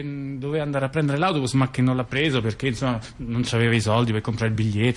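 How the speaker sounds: ordinary talk, nothing marked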